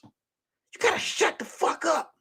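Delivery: high, soft, slow plea; urgent hush. Retold or imitated. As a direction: squeeze it out as a mock-desperate hush. Urging Teresa to keep secret.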